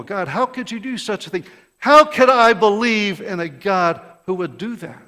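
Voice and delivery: rising from pleading to a loud, outraged protest; indignant outcry. Acted. Preacher voicing Jonah's outrage at God.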